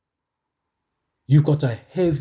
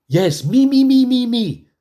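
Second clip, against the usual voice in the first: higher and louder, hammering each me; emphatic preaching shout; 9 semitones higher, 6 dB louder than usual. Real, not acted. Preacher stresses the Lord's deeds for him, shouting. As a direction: pound the word me like a preacher. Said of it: "emphatic repetition preaching".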